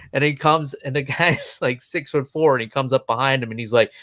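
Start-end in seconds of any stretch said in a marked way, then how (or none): none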